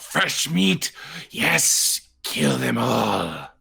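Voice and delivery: high, drawn-out bloodthirsty cries; shouting monster voices. Acted. Game master voices creatures shouting for fresh meat. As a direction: call it out like ghouls hungry for a kill. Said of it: "the voices shouting out".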